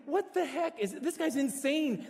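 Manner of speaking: high-pitched, drawn-out disbelief; mock-outraged astonishment; incredulous reaction. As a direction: act out baffled disbelief, voice jumping way up. Acts out own shocked reaction.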